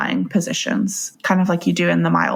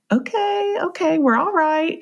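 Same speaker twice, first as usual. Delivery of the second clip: soothing midwife; 10 semitones higher than usual. Retold or imitated. Midwife soothing panicked mother.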